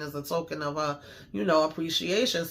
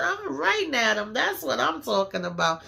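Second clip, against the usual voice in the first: squealing high with delight; high excited cheer; 7 semitones higher than usual. Real, not acted. Fan cheering the show's boss lady, high-pitched and excited. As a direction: cheer her on like a hyped fan.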